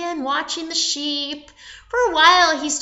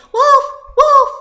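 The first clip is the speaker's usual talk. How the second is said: high, loud cry of wolf; raised, urgent call; calling out a warning; 12 semitones higher, 10 dB louder than usual. Acted. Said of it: "all you have to do is say".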